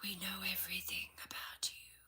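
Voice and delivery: leaned-in witch whisper, fast; a witch leaning in to whisper a threat; menacing whisper. A narrator's reading. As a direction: hiss it close to her ear. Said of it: "She leaned closer".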